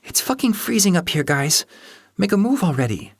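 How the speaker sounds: high, impatient complaint from the cold; freezing young woman. A narrator's reading. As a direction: grumble that you're freezing. Luna's teeth chattered.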